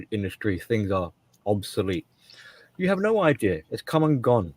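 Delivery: ordinary talk, nothing marked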